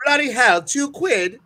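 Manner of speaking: high, loud, indignant mock Brit; mock British accent. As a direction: exclaim it like an outraged Brit. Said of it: "when I do a, a British accent".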